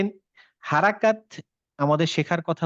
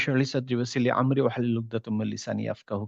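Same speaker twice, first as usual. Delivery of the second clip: soft, low Arabic prayer recited before the lesson; opening dua recitation; 4 semitones lower, 5 dB quieter than usual. Real, not acted. Teacher opening the lesson with a quiet Arabic prayer. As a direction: murmur the dua quietly to open class.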